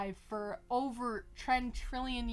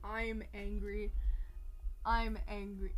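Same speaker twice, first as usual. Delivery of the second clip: deadpan anger; 2 semitones lower, 5 dB quieter than usual. Real, not acted. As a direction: state it with understated irritation.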